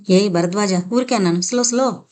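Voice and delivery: plain reading voice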